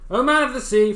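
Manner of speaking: loud high call to the sea. Acted. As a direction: call out over the waves. Fisherman calling to the sea.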